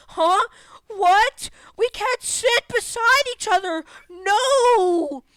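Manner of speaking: high, loud, incredulous whine; mock outrage. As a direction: wail it in exaggerated disbelief. Acting out kids' shocked protest.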